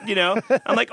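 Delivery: ordinary talk, nothing marked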